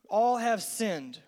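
"All have sinned" said very slowly; slow, weighty repeat; slow emphasis. Shown as a demonstration. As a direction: spell it out word by word. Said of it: "write down our first point".

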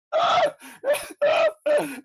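loud, high burst of laughter. Real, not acted. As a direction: burst out laughing at your own joke. Bursts out laughing at own joke.

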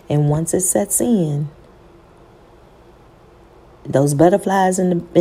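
normal talking voice

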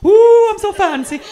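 high, loud, drawn-out mock whoop; drunken whoop. Drunk woman refusing to leave a party, whooping.

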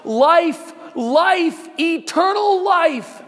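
high, loud cry of life; shouting out. Retold or imitated. Pilgrim running from his family, shouting high and loud. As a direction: shout it as he runs. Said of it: "but shouts out".

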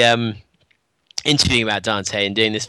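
ordinary talk

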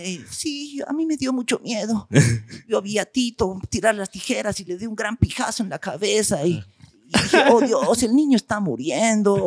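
put-on high, dramatic dubbed-show accent. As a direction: sister mimicking the dubbed Spanish accent of Rescate 911. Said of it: "el acento de Rescate novecientos once".

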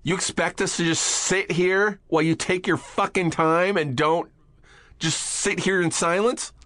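high, indignant retold outburst; angry actor telling off the assistant; mock angry actor. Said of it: "someone was like".

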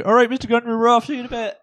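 high, drive-by farewell call; pretend calling out. Player shouting goodbye past the mayor's office, high mock call.

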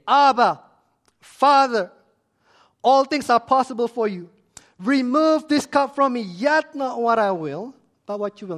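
loud, high cry to a father; anguished prayer. Acted. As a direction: cry out in impassioned pleading. Acts Jesus's anguished prayer in Gethsemane.

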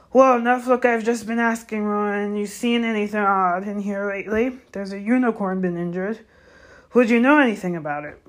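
Hagrid. Acted. Gamekeeper asks second centaur about injured unicorn, insistent. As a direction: burst in loudly, then keep pressing.

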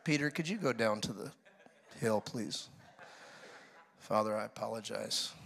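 low, soft, lightly voiced patient Jesus; a hint of an exasperated Jesus; weary Jesus. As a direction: sigh it out like a tired teacher. Jesus voiced dryly sending Peter away.

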